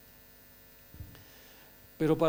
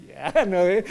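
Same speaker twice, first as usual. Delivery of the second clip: riendo fuerte; 3 semitones higher, 11 dB louder, slower than usual. Real, not acted. Ríe fuerte.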